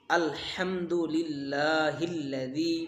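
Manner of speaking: slow, drawn-out Arabic recitation of আলহামদুলিল্লাহিল্লাযী; slow Arabic teaching demo. Shown as a demonstration. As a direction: stretch আলহামদুলিল্লাহিল্লাযী syllable by syllable. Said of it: "প্রত্যেকটা উচ্চারণ আমি যেমনে উচ্চারণ করি".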